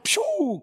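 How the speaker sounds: high-pitched "pew" whoosh; cartoon zoom noise. Preacher dashing onto the water, cartoon zoom noise. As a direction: make a cartoon take-off sound.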